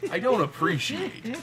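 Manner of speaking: high, slow, indignant whine; mock offended. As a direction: drag it out in fake outrage.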